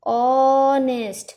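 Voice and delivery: ऑनेस्ट said slowly and loud, with no h; ऑनेस्ट starting straight on the vowel ऑ; silent h. Shown as a demonstration. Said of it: "एच silent रखते हैं".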